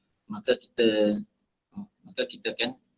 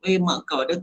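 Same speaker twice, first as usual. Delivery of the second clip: higher-pitched mock outburst of swearing; mock cursing; 6 semitones higher than usual. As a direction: blurt out a mild imitation curse. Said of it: "kalau kita sentiasa duk maki orang".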